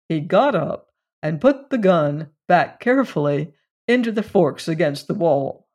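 ordinary delivery, nothing marked

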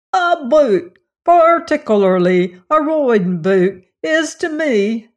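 loud, high Cockney rhapsody; theatrical Cockney boy's enthusiasm; enthusiastic Cockney. A narrator's reading. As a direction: enthuse grandly in broad Cockney. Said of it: "the enthusiasm of the last candidate".

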